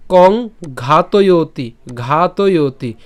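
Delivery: slow, stretched ঘাতয়তি with long first syllable; pronunciation demo. Shown as a demonstration. Teacher models the word for students, slow ঘাতয়তি. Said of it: "আমরা এটাকে একসাথে উচ্চারণ করব".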